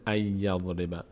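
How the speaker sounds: slow "ای یضرب" with a doubled Y; "ای یضرب" as "ay-ya-", no N heard; nun merged into ya. Shown as a demonstration. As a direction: hold the Y joining "ای" to "یضرب".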